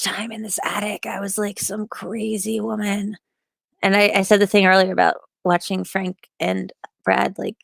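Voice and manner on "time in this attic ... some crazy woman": whisper. Real, not acted. Whispered self-mockery.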